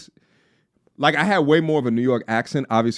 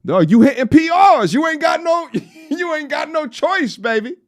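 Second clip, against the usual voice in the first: much higher and louder, shouting; hype shout; 4 dB louder than usual. Acted. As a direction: yell it like you're maxing out in the gym. Hyping gym music.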